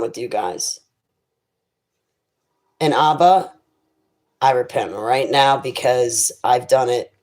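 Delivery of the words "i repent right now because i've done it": normal talking voice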